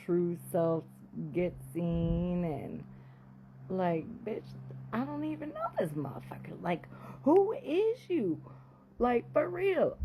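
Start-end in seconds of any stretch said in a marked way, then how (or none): 4.9-10.0 outraged disbelief. Real, not acted. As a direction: stretch out the shocked question. Shocked disbelief at husband.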